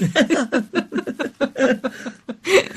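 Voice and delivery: long high laugh trailing on; real amused laughter. Real, not acted. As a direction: keep laughing after the punchline. Laughter after the Einstein anecdote.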